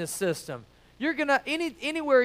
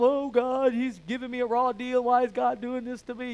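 softer, sulky mock lament; mock complaining; 3 dB quieter than usual. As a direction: whine it like someone given a raw deal. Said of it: "when afflictions come".

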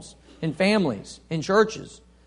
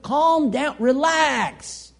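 lightly voiced higher calming plea; soothing Paul; 7 semitones higher, much slower than usual. Preacher voicing Paul calming quarrelers, soothing. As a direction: gently talk an angry man down. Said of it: "Paul says".

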